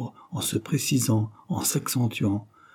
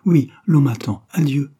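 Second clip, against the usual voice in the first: abrupt; 5 semitones higher, 7 dB louder than usual. A narrator's reading. Abrupt departure from Claude.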